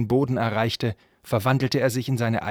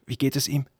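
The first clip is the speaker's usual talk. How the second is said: slightly higher, anxious thin voice; 4 semitones higher than usual. A narrator's reading. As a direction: ask anxiously with little voice behind it. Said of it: "wie dünn seine Stimme klang". (mit dünner Stimme gefragt)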